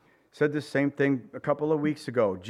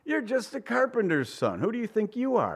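scoffing; 8 semitones higher than usual. Retold or imitated. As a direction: sneer it down at him, pitched way up. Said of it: "the people scoffed".

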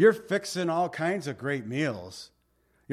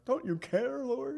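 high-pitched mock plea; imitating a put-out complainer; plaintive complaint; 9 semitones higher, 4 dB quieter than usual. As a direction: plead it upward as an aggrieved question. Acting out Martha's complaint to the Lord.

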